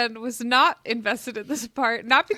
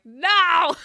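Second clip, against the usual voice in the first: louder, higher no; loud protest; 6 semitones higher, 6 dB louder than usual. Retold or imitated. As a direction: shout the no in dismay. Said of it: "I was like".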